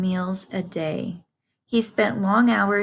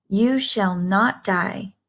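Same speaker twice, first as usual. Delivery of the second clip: ordinary delivery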